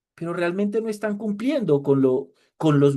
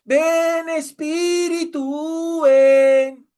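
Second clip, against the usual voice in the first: high, loud, drawn-out mock wail; hammed-up crying out to God; emotional worshippers; 10 semitones higher, 5 dB louder, much slower than usual. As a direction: wail like an overwrought congregant, vowels stretched. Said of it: "se llenan de emoción, lloran".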